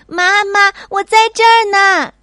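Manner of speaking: high, loud shout to mom. Acted. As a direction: yell so mother can hear. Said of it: "小希大喊". (小希对着洞外的妈妈大喊)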